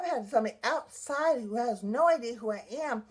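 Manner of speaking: usual speaking voice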